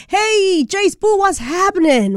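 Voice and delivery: high, drawn-in radio greeting; high-pitched hailing. Real, not acted. Host calls out to beach reporter.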